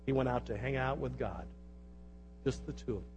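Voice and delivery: low, soft, intimate aside; quiet, reverent storytelling; hushed. Real, not acted. Hushed aside inside the reading.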